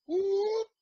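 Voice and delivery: loud, high-pitched "whoop"; sudden comic whoop of popping into view. Retold or imitated. Acting out popping up on screen.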